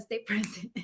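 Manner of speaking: quiet chuckling repeat of her own motto; laughing. Shown as a demonstration. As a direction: stay present said softly through a laugh. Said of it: "That's what I always tell myself".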